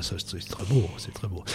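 soft whispered admiration under the breath; whispered aside. Real, not acted. Guest whispering praise while the host talks. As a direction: murmur it as a private compliment. Quiet aside during introduction.

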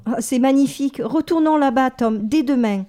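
high, loud gushing delight; excited girlish cry; enthusiastic. Acted. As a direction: swept away, begging to go back. Daisy gushing about the town.

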